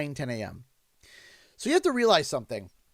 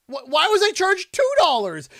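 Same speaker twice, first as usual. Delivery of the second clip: indignant; 10 semitones higher, 7 dB louder than usual. Real, not acted. Overcharged for coffee.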